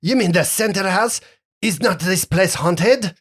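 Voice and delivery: put-on high, wary French-accented question; half French accent. Captain queries the haunted center house, French accent. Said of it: "half French accent".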